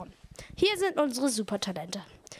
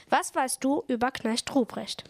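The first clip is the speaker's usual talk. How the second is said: ordinary talk, nothing marked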